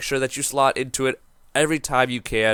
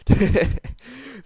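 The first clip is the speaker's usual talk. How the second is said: high laugh; 12 semitones higher than usual. Real, not acted. Laughs at own bit.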